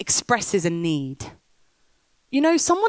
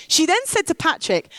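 normal talking voice

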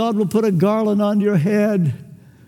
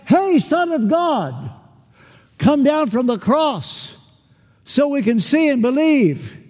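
higher, slow scoffing call; mock jeering shout; 5 semitones higher than usual. Crowd at the cross, slow, higher mocking jeer. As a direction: taunt him like a mocking crowd.

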